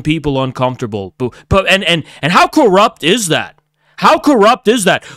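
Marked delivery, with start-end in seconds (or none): 4.0-5.1 fed-up, pressing the point home. Real, not acted. Repeats the question, louder second time.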